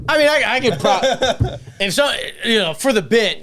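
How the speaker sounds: a light mock of a flustered comedian; imitating Joe. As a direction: play the nervous guy covering himself. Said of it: "And Joe DeRosa's like".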